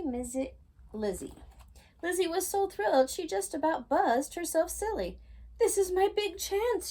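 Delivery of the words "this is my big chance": high excited shout. A narrator's reading. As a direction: shout it with thrilled joy. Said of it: "she shouted".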